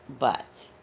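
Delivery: ordinary talk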